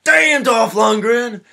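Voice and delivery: slow, high, loud cheer; drawn-out high yell of admiration; amazed shout. Retold or imitated. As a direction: shout his name in awe. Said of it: "they're just like".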